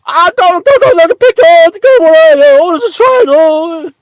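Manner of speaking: squeaky high falsetto whine, loud; mocking a terrified follower in a squeaky falsetto; mock scared believer. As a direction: ham up a frightened sheep.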